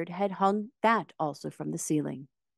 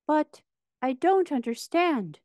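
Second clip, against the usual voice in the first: bewildered; 11 semitones higher than usual. A narrator's reading. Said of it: "in bewilderment".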